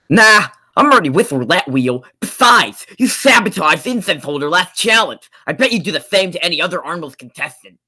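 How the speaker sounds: high, loud cartoon character voice; squeaky sassy cartoon contestant; Retainer voice. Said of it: "do your retainer voice".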